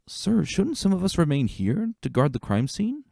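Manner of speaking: a new soldier daring to speak up; nervous young recruit. Acted.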